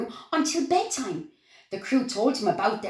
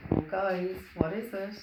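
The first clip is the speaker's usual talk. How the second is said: ordinary delivery, nothing marked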